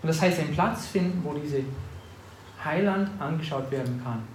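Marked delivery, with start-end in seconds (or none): none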